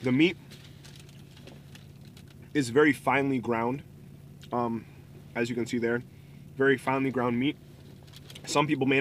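chewing while talking; speaking around food; mouth full. Real, not acted. Seen 1: Bite taken just before; jaw working, food visible while speaking.

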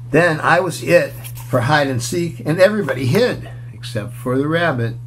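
usual speaking voice

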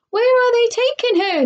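high, panicked shriek; very high-pitched alarm. A narrator's reading. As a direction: demand it like a frantic mother. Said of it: "shrieked Missus Salt".

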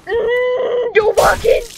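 loud, high shouted war cry; battle cry. Pretend. Gamer attacking a boss, shouted war cry. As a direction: roar it as you attack.

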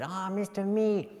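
put-on excited caller in a high, drawn-out Argentinian accent. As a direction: imitate an Argentinian fan, pitched high and slow. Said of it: "He's from Argentina".

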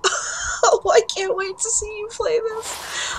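high giggly squeal; squealing giggle about her friend; giggling glee. Real, not acted. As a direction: giggle with anticipation. Giggling about friend's upcoming scare.

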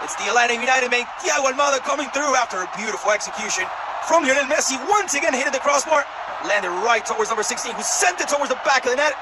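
goal call. Real, not acted. Goal called live.

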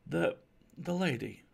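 sudden hesitant question; abrupt. Acted. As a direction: break in abruptly, stumbling on the first word. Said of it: "said Will abruptly".

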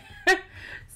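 breathy high-pitched giggle; a quick squeaky laugh; airy little giggle. Real, not acted. Laugh after delicioso joke.

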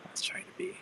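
breathy whisper to herself; a whispered confession; whispered aside. Real, not acted. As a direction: drop to a whisper, half-embarrassed. Whispered aside after own question.

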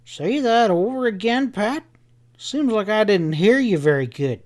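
put-on high, strained old friend's voice; harsh, rasping. A narrator's reading. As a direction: demand a repeat, harsh and high-pitched. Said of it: "his voice was harsh and rasping".

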